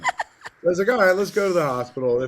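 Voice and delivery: high laughing reluctant yes. Retold or imitated. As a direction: laugh it out and give in.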